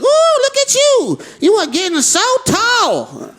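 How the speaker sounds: put-on high, slow, cooing old lady; stretched-out sweet granny exclamation; grandma voice. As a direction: coo it like a doting grandmother. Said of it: "my grandmother's always like".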